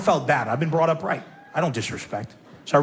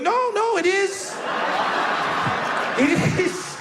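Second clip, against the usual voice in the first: mock insistent reply; 11 semitones higher than usual. George's mock-insistent reply in the story.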